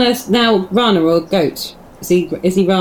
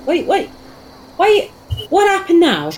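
panicked shout across the camp; 11 semitones higher than usual. Acted. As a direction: yell after Rana, alarmed. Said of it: "and start shouting".

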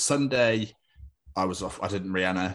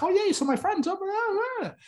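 much higher mock friend voice; imitating a friend. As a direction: quote him in a silly high voice. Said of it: "So he was like".

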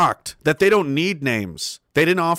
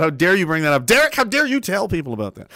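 normal talking voice

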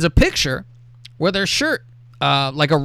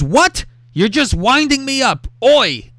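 high-pitched mock indignation, loud at the end; mock outraged disbelief; 10 semitones higher, 4 dB louder, slower than usual. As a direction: protest like an affronted boyfriend, finishing on a loud call. Reads boyfriend's outraged reply.

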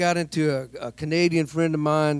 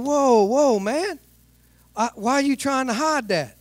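indignant; 7 semitones higher, 3 dB louder, slower than usual. Retold or imitated. Protesting loudly in the office.

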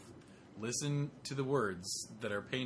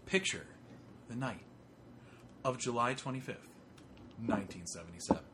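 storyteller voice; 6 dB quieter than usual. Acted. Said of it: "total A S M R right now".

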